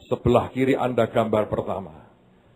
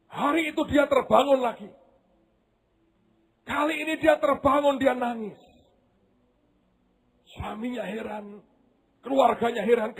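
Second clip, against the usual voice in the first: high, intense storyteller; preacher telling of the weeping mother; fervent preaching; 12 semitones higher than usual. Acted. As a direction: drive the story forward. Preaching narration.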